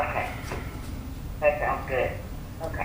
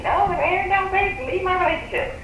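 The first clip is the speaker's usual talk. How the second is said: much higher mock voice of the reader; imitating the reader. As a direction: mimic the reader teasingly, up high. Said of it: "I know you like to say".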